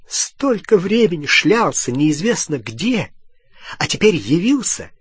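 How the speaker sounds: high-pitched angry tirade; indignant scolding. A narrator's reading. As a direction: berate him, incredulous at his nerve. Hermione berates Ron scornfully.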